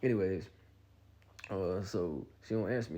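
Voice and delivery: ordinary talk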